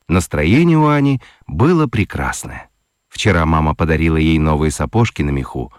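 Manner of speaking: usual speaking voice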